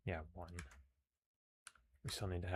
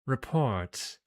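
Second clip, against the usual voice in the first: higher, commanding British villainess; British accent; 5 semitones higher, 7 dB louder than usual. Acted. Commander demands a report from her agent, British accent. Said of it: "I gave her a British accent".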